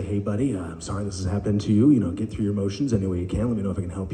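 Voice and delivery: low, soft, quick reassurance; gently consoling. Retold or imitated. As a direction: soothe a child through a tantrum. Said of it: "get on his level and say".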